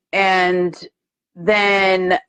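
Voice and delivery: usual speaking voice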